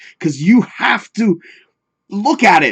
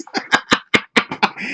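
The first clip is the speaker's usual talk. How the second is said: wheezy laugh; 4 dB quieter than usual. Real, not acted. Laughs about the sponsor.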